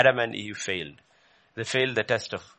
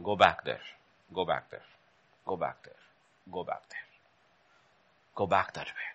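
softer and softer each repeat; fading command; 7 semitones lower, 6 dB quieter than usual. Real, not acted. Preacher urging return, fading to a hush. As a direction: let it fade to almost nothing.

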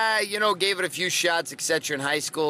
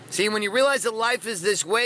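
ordinary delivery

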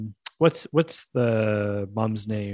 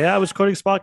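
put-on louder, higher kid protesting the punishment; indignant protest; 8 semitones higher, 4 dB louder, faster than usual. Kid defending himself to the school, mock indignant. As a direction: plead your innocence, a bit loud. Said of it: "And I was like".

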